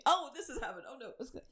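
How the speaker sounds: high-pitched flustered outburst; sudden hammed-up alarm; mock panic. Back to mimicking a panicked high.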